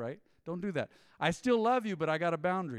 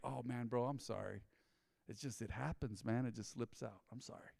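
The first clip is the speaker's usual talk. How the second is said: sheepish apology; 4 semitones lower, 9 dB quieter than usual. Retold or imitated. Coworker apologizing for cussing.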